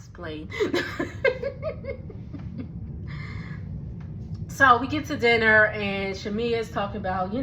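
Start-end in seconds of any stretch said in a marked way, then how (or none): 0.5-4.6 breathy laugh. Real, not acted. Laughing at airport noise.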